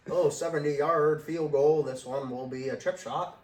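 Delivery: hammy vintage newsreel announcer; 1940s announcer voice. Pretend. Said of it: "Why are you using a nineteen forties voice?".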